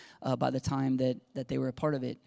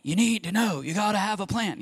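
higher-pitched fatherly urging; insistent dad; 5 semitones higher than usual. Retold or imitated. Dad pressing undecided daughter for a plan, insistent. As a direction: let the dad rise up in you. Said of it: "the dad's rising up in me".

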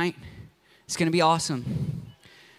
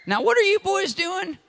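put-on high, curious old lady; lightly voiced old lady; 14 semitones higher than usual. Old lady asking the boys what they do, high and curious. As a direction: ask it as a puzzled grandma.

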